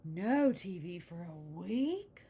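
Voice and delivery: slow, loud shocked cry of disbelief. A narrator's reading. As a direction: gasp it out as if the TV were gone forever. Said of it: "said the shocked cubs".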